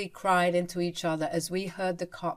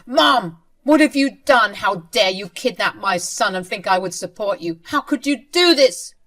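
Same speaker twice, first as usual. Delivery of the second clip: roaring at mother; 3 semitones higher, 9 dB louder than usual. Acted. Son confronting his mother over kidnapping his boy, loud furious roar. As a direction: let it explode at her. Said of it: "He roared at Anne and said".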